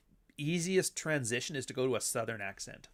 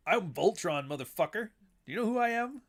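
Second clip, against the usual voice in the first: cocky Voltron; 8 semitones higher, 3 dB louder than usual. Retold or imitated. Mocking Voltron's boast.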